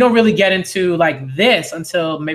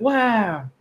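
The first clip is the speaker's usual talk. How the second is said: drawn-out, higher wow of wonder; awed Luffy; 5 semitones higher than usual. Acted. Boy hears of pirate freedom, awed. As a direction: gasp it out like an impressed kid. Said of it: "Luffy's like".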